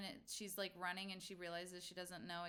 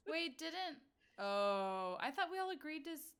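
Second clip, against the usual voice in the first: surprised; 6 semitones higher, 4 dB louder than usual. Real, not acted. Player surprised at betrayal.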